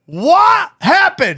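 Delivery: high, very slow bewildered ask; asking the friend how he missed his own car; stunned question. Retold or imitated. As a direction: drag it out in disbelief. Retold disbelief.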